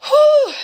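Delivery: high, loud exhale while holding back tears; real emotional release before going on; emotional whew. Real, not acted. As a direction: blow out a breath to steady yourself. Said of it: "I'm getting really emotional about this".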